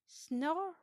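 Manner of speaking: high, loud mock snore. A narrator's reading. Narrator mimics George snoring loudly in his sleep, playful.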